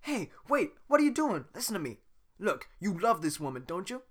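high, loud pleading; urgent Donkey voice. Acted. Donkey stopping Shrek, high and urgent. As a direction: chase after him with it.

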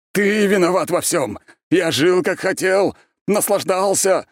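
high, loud ranting; furious accusation. A narrator's reading. Mad ex-emperor blames the archmage, ranting. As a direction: rant in bursts of outrage.

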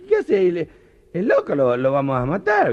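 put-on Argentine accent. Train drivers spotting the parrot, high Argentine accent. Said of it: "contarlos con acento argentino".

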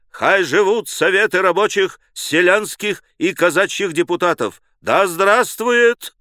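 rally speaker's exclamations; crying out slogans. A narrator's reading. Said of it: "вскрикивал".

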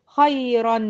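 "خيرا" slowly as khayran; careful "خيرا" ending in an unvowelled n; demonstrating tanween. Shown as a demonstration. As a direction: sound a clear final n on "خيرا". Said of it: "دي تنوين".

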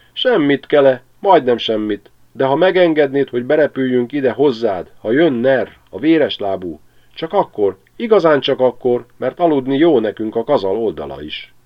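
high, loud, pleading little sparrow; esedező veréb. A narrator's reading. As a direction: beg the big stork humbly, in a small high voice. (a veréb könyörög Kelének, magas, esdeklő hangon)

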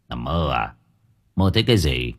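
husband's quiet irritated snap; snapping softly. A narrator's reading. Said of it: "Ông Sửu khẽ gắt".

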